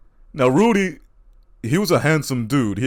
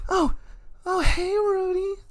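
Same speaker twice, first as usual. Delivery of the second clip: very high, shocked then melting into flirty. Retold or imitated. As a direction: start outraged, then swoon when you see him. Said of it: "she'd be like".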